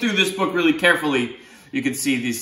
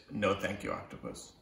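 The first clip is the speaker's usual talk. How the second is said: softer, lower, sinking into a whisper; soft line fading to a whisper; 6 semitones lower, 14 dB quieter than usual. Acted. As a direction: let it trail off to a breath. Back cover joke, dropping low.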